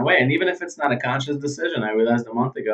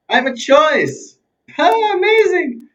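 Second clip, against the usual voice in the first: mock amazement; 4 dB louder, slower than usual. Mock delight at having a choice.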